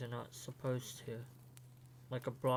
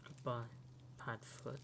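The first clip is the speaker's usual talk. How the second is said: 1 soft farewell; 9 dB quieter than usual. Acted. Farewell to a doomed friend.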